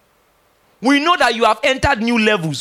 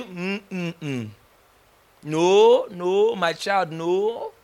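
gentle divine no; 2 semitones lower, 4 dB quieter, much slower than usual. Retold or imitated. Holy Ghost gently forbidding.

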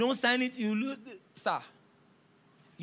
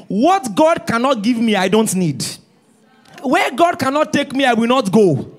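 preaching at full force; 3 semitones higher, 11 dB louder than usual. Acted. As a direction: declare it to the congregation.

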